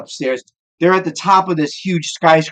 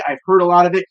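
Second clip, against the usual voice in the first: ordinary talk, nothing marked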